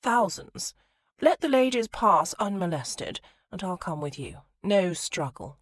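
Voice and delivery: put-on a lightly done upper-class English accent; aristocratic accent. Colin bargains with the robber in a slight aristocratic English accent. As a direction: lay on a touch of aristocratic English polish. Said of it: "brought out his most aristocratic accent".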